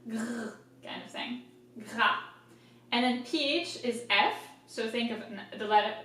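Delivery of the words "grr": soft throaty "grr"; a quick quiet growl on the French R; little cartoon growl; comic-book growl. Shown as a demonstration. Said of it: "you see in comic books".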